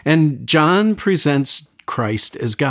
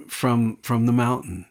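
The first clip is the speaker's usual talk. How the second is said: usual speaking voice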